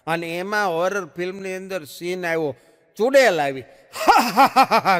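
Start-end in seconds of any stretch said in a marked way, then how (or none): 4.1-5.0 high, loud witch's cackle. Retold or imitated. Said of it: "કરતી".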